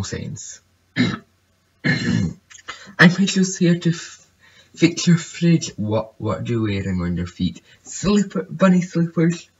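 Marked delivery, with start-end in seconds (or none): 1.0-5.7 high, put-on goofy repairman. Voicing the repairman sim. 7.8-9.5 high goofy repairman answer. Pretend. Repairman answering about slippers.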